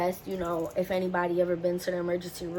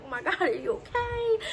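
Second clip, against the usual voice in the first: high-pitched frantic mom outburst; panicked mom; 10 semitones higher than usual. Retold or imitated. Puerto Rican mom hears of daughter's fall, mock panic. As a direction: imitate a mom going crazy with worry. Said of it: "they go crazy when they hear".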